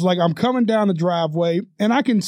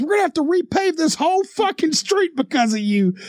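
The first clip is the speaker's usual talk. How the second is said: high-pitched imitation of an angry worker; retold outraged complaint; furious scolding; 10 semitones higher than usual. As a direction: lay the blame in a mock yell. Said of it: "vehemently screaming at me".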